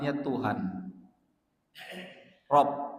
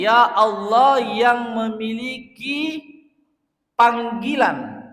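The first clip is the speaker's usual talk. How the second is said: high, slow, loud invocation; impassioned preaching; 7 semitones higher, 5 dB louder, much slower than usual. Acted. Preacher exalting God before the congregation, high and passionate. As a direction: lift the voice in fervent praise.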